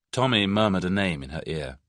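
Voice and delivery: usual speaking voice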